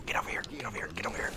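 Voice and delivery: urgent whisper; hushed urging. Real, not acted. Player luring an enemy in the game, whispering. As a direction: whisper it low, coaxing them closer.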